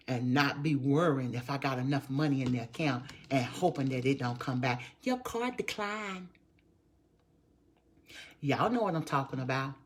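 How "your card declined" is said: mock card machine. Mimics the card machine's message.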